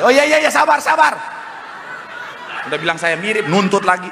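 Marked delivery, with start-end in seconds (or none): 0.0-1.1 high-pitched, rapid-fire reassurance; hurried placating. Real, not acted. Preacher stalls audience wanting an encore, high playful placating. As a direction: pitch jumps up to hold off an eager crowd. Placates crowd asking for a repeat.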